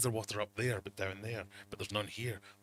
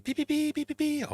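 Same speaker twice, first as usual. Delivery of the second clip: high, piercing mock alarm beeping, rising louder; imitated alarm beeps; 8 dB louder than usual. Imitating the tank alarm for viewers, high beeping. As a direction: beep like a tank alarm going off. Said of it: "It's going".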